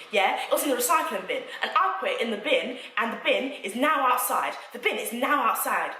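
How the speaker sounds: mocking an angry landlady's rant. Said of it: "this woman comes screaming".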